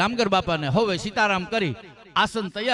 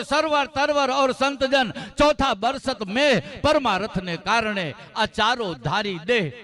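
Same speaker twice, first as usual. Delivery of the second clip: slow, raised couplet recitation; recited couplet; 5 semitones higher, slower than usual. Acted. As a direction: intone the verse slowly. (ધીમે, ઊંચા સ્વરે દોહો)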